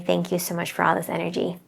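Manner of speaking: soft and fast, almost to herself; hushed, rapid gratitude; quiet thanks. Shown as a demonstration. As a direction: murmur the thanks under her breath, quickly. Thanking the energy in private.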